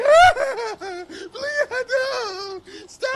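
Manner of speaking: very high, loud pleading shriek; panicked leader. Acted. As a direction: wail for mercy in hammed-up terror. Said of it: "and he's like".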